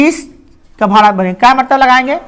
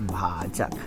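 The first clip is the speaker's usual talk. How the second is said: timid whisper; 19 dB quieter, much slower than usual. Shown as a demonstration. Teacher mimics a class unsure of the answer, whispering. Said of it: "हाँ, धीरे धीरे निकला ना".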